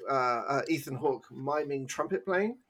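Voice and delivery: ordinary delivery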